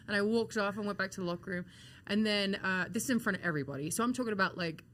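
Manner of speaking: ordinary talk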